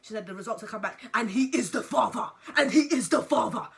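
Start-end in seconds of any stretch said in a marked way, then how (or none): 1.1-2.3 loud, gloating mock proclamation; triumphant announcement. Mother crowing over the DNA results, loud and triumphant. 2.6-3.7 much louder gloating proclamation; triumphant announcement. Retold or imitated. Mother rubbing in the DNA results, loud and crowing.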